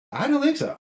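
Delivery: high, mocking "I don't think so"; sarcastic scoff. Real, not acted. Host answering his own question with a mocking high scoff. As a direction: toss it off with a scornful jump up.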